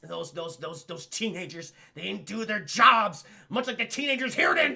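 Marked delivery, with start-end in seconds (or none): none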